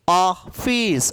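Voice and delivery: ऑफिस slowly, split in two, open ऑ; open ऑ vowel. Shown as a demonstration. Teacher models ऑफिस for students, slow open ऑ. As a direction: stretch the ऑ in ऑफिस. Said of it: "अ का उच्चारण".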